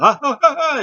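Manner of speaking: high, creepy little cackle; imitated loud eerie villain laugh; Bray Wyatt laugh. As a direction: do a creepy high-pitched giggle. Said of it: "his little creepy laugh".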